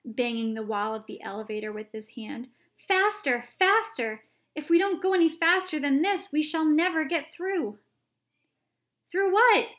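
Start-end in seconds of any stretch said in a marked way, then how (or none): 2.9-7.8 high, loud urging; shouted urging; urgent shouting. A narrator's reading. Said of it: "cried Mister Wonka". 9.1-9.7 high, loud question; startled shouted question; alarmed shout. A narrator's reading. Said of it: "shouted Grandpa Joe".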